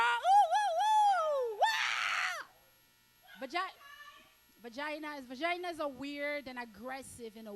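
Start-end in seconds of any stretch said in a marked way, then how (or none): 0.0-3.5 high, loud playful squeals; mock squeal. Shrieking at the crowd after the rant, high playful squeals. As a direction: squeal like an excited girl.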